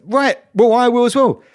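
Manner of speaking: high, loud mock defiance; acted defiant protest. Driver justifying himself to engineer, defiant and high-pitched. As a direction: insist on it, pitched right up. Retold boast about matching teammate.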